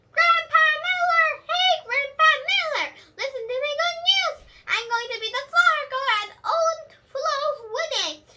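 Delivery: very high, loud shout of news; excited yelling. A narrator's reading. Junie B. shouting her news to Grandpa Miller, excited yell. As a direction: call across the room bursting with joy.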